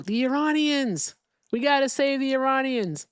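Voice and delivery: mock-serious echo of a rallying cry. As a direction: parrot their slogan with scorn. Mocking others' talking point.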